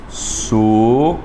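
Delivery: Suávamos drawn out slowly, split as su-á-va-mos; slow syllable split. Shown as a demonstration. Teacher showing the class how Suávamos splits, slow and separated. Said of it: "Como é que fica suávamos".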